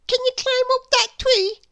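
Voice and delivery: put-on bright childlike schoolboy voice; young William. A narrator's reading. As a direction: pitch it up like an eager small boy. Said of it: "he asked".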